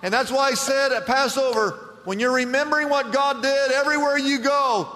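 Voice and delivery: high, loud, driving sermon voice; full-force pulpit retelling; fiery preaching. Acted. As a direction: preach it hard, climbing with every line. Closing exhortation at full force.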